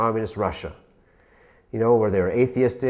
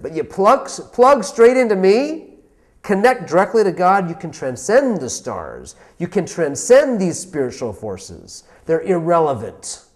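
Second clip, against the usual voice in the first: higher, louder, slow and insistent; God answering firmly; 6 semitones higher, 5 dB louder than usual. Retold or imitated. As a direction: lean into it, a voice reassuring and commanding at once. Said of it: "He said like this".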